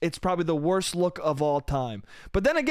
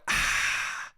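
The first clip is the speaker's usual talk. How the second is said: a short whispered exhale, ah; whispered after-sip ah. Real, not acted. Comic annoyed at himself, a whispered gasp ah. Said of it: "I just went".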